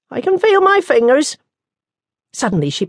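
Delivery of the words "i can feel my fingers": nun voice. Acted.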